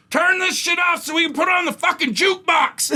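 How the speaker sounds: high-pitched mock scream; screaming heckler. Guy at the bar interrupts comedy set, screaming. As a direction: holler it like an irate barfly. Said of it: "He just starts screaming".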